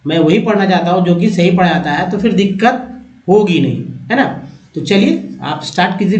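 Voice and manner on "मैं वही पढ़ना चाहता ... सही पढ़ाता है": usual speaking voice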